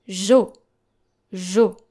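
"jo" as zho, the e silent; French soft g. Shown as a demonstration. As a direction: say "jo" with a French zh and round o. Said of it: "so we pronounce it this way".

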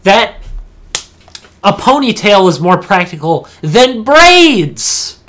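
loud, high, drawn-out disbelief; incredulous rant. Real, not acted. Gamer scoffing at character's hairstyle, incredulous. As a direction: build to a loud stretched last word. Mock outrage about braided hairstyle.

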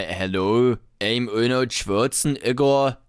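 slow, heavily accented action-star impression; Arnold Schwarzenegger. Host claims he's from Florida, slow Austrian-accented parody. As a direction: parody thick Austrian-accented English, drawn out. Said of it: "Arnold Schwarzenegger kann auch sagen".

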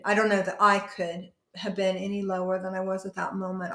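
normal talking voice

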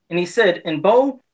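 ordinary delivery

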